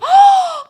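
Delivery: sharp high gasp of alarm; storyteller's dramatic gasp; shocked gasp. Acted. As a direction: gasp as if the wing just caught fire. Wingtip catches fire.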